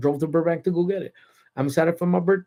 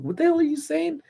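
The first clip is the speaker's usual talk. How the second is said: incredulous; 13 semitones higher than usual. Retold or imitated. Cousin butts in on dad.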